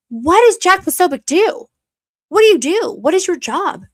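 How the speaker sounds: high, loud incredulous jab; mocking challenge. Real, not acted. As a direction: demand it like a prosecutor.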